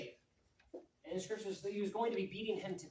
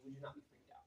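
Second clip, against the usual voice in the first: unvoiced, hushed aside; a hushed aside to the youth; whisper; 6 semitones lower, 20 dB quieter, much faster than usual. Real, not acted. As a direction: lean in and whisper it. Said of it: "whispered aside to audience".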